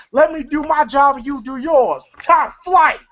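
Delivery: high, puffed-up mock authority; bossy retelling. Day Day telling Craig off, high self-important bossiness.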